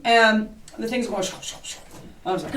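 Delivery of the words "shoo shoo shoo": soft unvoiced shoo of a spirit box; imitated static hiss. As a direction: breathe the static sound out.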